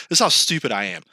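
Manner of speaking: normal talking voice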